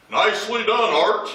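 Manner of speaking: high, loud call from hiding; hidden voice calling. Retold or imitated. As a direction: sing out praise like a mysterious voice. Hidden dad calls out to preacher.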